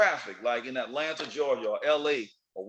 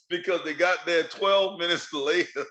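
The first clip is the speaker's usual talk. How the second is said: normal talking voice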